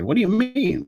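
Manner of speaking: very high-pitched puzzled quote; put-on high protest; mock incredulity. Voices the listeners' puzzled objection.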